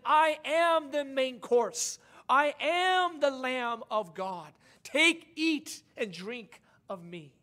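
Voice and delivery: lightly voiced Jesus. Jesus offering himself to disciples, slow solemn declaration. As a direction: proclaim it slowly as Jesus would.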